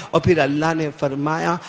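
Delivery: usual speaking voice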